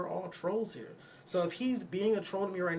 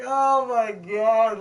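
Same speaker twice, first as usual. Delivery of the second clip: exasperated; 4 semitones higher, 11 dB louder, much slower than usual. Retold or imitated. Reacting to racist accusations.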